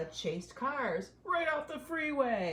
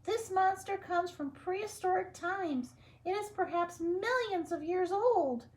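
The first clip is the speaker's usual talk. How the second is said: very high squeaky scientist voice; professor; 12 semitones higher, 5 dB louder than usual. Acted. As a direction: address the mice like a prim professor. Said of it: "said Professor O'Hare".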